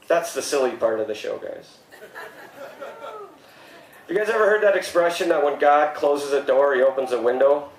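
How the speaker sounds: normal talking voice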